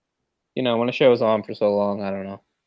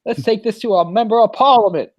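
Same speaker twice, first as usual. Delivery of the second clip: higher, hammed-up Australian accent; imitating Australian accent; 9 semitones higher, 4 dB louder than usual. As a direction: play an outraged Aussie neighbor, a bit over the top. Simpsons Australia episode, Aussie neighbor.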